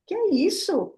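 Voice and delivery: high, slow outraged disbelief; refusing to own the illness, indignant and high; indignant surprise. Retold or imitated. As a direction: reject it as if shocked. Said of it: "Eu digo".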